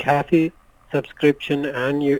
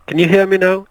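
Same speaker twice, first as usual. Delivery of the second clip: louder, clearer; 6 semitones higher, 10 dB louder than usual. Real, not acted. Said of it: "Yes, I can hear you now".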